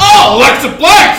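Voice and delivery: high, loud, drawn-out holler; hammed-up excited shout; re-enacted yell. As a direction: yell the name out slowly. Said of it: "like I yelled at".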